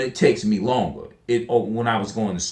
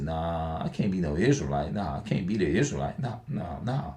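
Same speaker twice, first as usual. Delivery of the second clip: muttered denial; 6 semitones lower, 8 dB quieter than usual. Retold or imitated. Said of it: "I'm like, I said".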